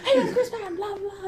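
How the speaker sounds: very high, mock self-introduction; hammed-up eager greeting, half laughing; Chris Patton. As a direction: announce yourself over the mic in a silly high voice. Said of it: "And he's like".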